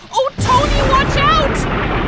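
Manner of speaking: high, loud cry of alarm; frightened warning shout. Acted. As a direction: scream a warning to him. Lightning flashing near brother.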